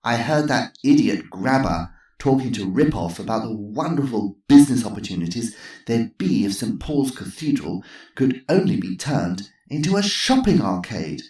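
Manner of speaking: mild snort of contempt; scornful old lady. Acted. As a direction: dismiss the idiots. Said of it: "snorted Missus Twistleton".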